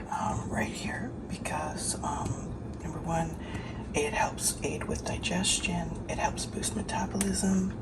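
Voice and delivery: quiet early-morning whisper. Real, not acted. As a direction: whisper so nobody wakes up. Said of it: "so early that I have to whisper".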